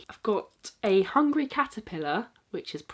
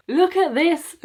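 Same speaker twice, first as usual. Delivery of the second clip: high, loud excited exclamation; 10 semitones higher, 9 dB louder, slower than usual. Real, not acted. Vlogger showing off a birthday present, excited. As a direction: burst out with delight, high and loud.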